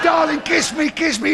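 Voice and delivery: high, loud kiss me; breathless demand for a kiss; passionate plea. Retold or imitated. Woman pleading passionately.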